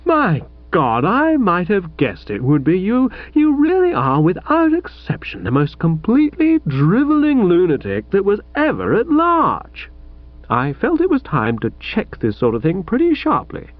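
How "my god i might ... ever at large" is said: lightly voiced moaning exasperation rising high; a hint of a wail at the lunatic. A narrator's reading. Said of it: "he said in a sort of moaning way".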